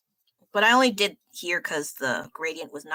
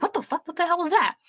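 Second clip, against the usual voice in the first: much higher, shocked retelling of the class's reaction; startled disbelief; 10 semitones higher, faster than usual. Retold or imitated. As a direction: toss it off high, as if jolted out of your seat.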